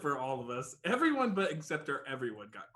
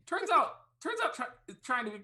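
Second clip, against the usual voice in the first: high excited; 12 semitones higher than usual. Real, not acted.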